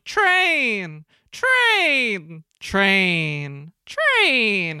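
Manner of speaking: sung-out warning horn; drawn-out falling horn calls; train horn imitation. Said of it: "train in the background just going".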